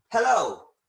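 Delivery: a friendly phone-answer hello; bright phone greeting. Shown as a demonstration. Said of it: "you could answer by saying".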